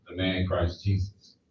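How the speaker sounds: usual speaking voice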